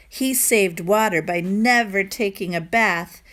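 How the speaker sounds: ordinary delivery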